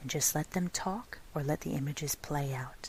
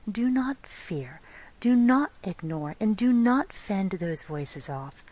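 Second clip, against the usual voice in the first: each "do not" pressed firmly, slow, "not" louder; firm emphatic negatives; 4 dB louder, slower than usual. Shown as a demonstration. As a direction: hammer home every "do not". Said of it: "let me emphasize".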